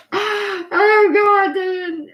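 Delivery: high, loud gasp of disbelief; shocked exclaim. Real, not acted. Reacting to chat about shared husbands, loud shocked exclamation. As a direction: blurt it out like you can't believe it.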